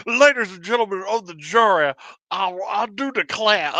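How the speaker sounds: put-on Southern accent, orating to a jury; honeyed southern lawyer drawl. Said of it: "sounds like every Southern lawyer ever, where he's, he's like".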